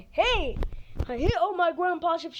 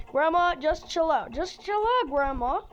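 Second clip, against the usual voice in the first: ordinary talk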